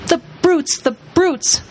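put-on high tearful girl's cry; tearful outrage. A narrator's reading. Lucy crying at the brutes, high tearful outrage. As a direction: cry it out, upset. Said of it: "tears streaming down her cheeks".

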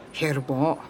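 softened, secretive reply; quiet. A narrator's reading. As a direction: answer quietly, as if sharing secrets. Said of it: "He spoke quietly too".

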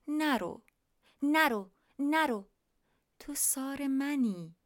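pleading. Acted. Bibi begs bird not to go.

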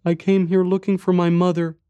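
put-on high, small child voice; little boy. A narrator's reading. Boy tells stranger about lost mother, little boy's voice. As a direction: speak as a young boy, pitched high. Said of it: "he said".

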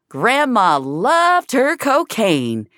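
loud, slow tabletop toast; drunken toast. A narrator's reading. Finch toasting a room of grieving drunks, loud and slow. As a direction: raise the bottle and toast the room. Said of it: "is all she could think to say".

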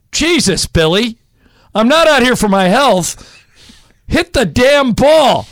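high, loud mock yell as Lasorda; a gruff manager barking at the batter; Lasorda impression. Lasorda yelling at a hitter.

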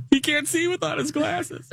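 high quoting voice from the film; movie quote in a put-on high voice; My Girl quote. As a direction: pitch up into the movie kid's line. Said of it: "My Girl reference".

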